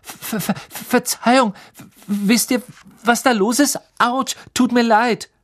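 put-on a panicky boy stuttering apologies; stammering Neville. A narrator's reading. Neville stammering after crashing into the compartment.

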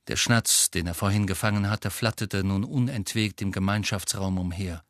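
plain reading voice

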